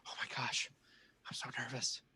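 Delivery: hushed, whispered imitation of nerves; nervous presenter. Voicing nervous presenters before stage.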